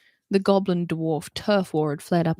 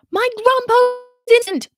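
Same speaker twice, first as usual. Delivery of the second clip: put-on high, loud squawk of an outraged old woman; protesting granny; 9 dB louder than usual. Old protester yelling at Holly, high and loud. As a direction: shriek it at the officer, much higher and louder.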